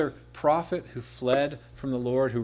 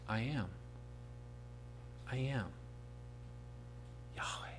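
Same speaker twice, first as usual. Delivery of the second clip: hushed reverent name; 15 dB quieter than usual. Acted.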